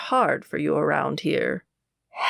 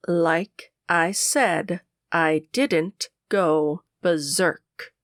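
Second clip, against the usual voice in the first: "Like I said, I didn't go berserk" slow, every word stressed apart; each word emphasized; much slower than usual. A narrator's reading. Kiera insisting to the interrogator, slowly stressing each word. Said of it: "emphasizing each word".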